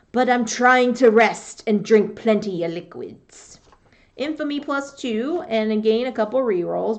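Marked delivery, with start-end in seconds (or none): 0.1-3.6 louder, gruff sickly pirate. Acted. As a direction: growl it like a feverish old pirate. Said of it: "Here we go with my pirate voice".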